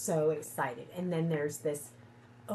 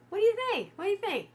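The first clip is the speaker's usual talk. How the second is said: high playful ask; 5 dB louder, much faster than usual. Real, not acted. Asks viewers' opinion.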